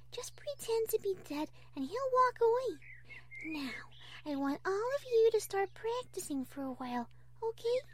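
high, soft, kindly teacher; Fluttershy. Acted. Fluttershy instructing the cheering baby animals, high and gentle. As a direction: encourage little ones in a sweet high voice.

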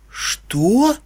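stunned. A narrator's reading. Unicorn learns of an unknown brother, stunned. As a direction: gape in disbelief. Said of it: "Челюсть единорожки со стуком упала".

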